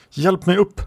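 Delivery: high, insistent command; urgent demand. A narrator's reading. Wounded woman to the man holding her, high urgent demand.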